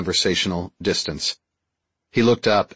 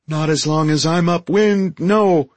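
high, squeaky alien voice; high-voiced Deltan; 8 semitones higher, 4 dB louder than usual. Deltan reassures friend about the smell, high voice. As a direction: answer in a high creature voice. Archimedes' voice in dialogue, teasing.

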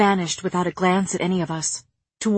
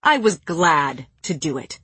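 lower, deliberate snarl; 4 semitones lower than usual. A narrator's reading. Jurian repeats his defence, snarling low. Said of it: "Jurian snarled".

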